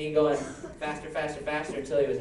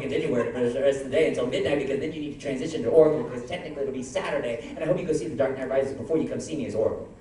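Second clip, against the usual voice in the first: fast talking; 2 semitones lower, 4 dB louder, faster than usual. Real, not acted. Fast reply to speed joke.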